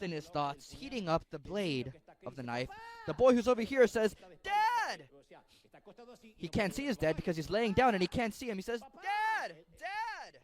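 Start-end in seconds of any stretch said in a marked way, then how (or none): 4.4-5.1 put-on much higher, a child's cry of "Dad!"; boy calling out. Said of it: "The boy's shouts". 9.0-10.4 put-on much higher, a child's cry of "Dad!"; boy calling out. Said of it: "The boy's shouts".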